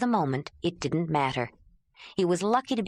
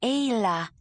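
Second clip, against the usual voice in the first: lightly voiced "Ayla", a touch higher, clipped; strange clipped accent; 4 semitones higher than usual. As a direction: say "Ayla" with two short clipped parts, swallowed back in the throat. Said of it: "She had a strange accent".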